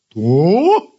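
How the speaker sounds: drawn-out, surprised question; a dog taken aback. A narrator's reading. Dog hearing the kitten's name, surprised. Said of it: "удивилась собака".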